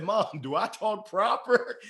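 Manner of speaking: hammed-up tearful kid; mock crying. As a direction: appeal to mom in a put-on wail. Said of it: "I went crying to my mom".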